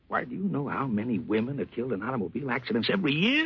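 ordinary delivery

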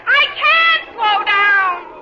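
alarmed wail, stretched out; panicked shout. Acted. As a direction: yell back as you careen downhill. Runaway skier calls back.

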